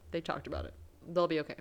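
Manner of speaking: ordinary talk, nothing marked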